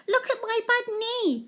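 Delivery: put-on high little girl voice; high-pitched child's "look"; child showing knee. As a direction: show off the knee like an excited child.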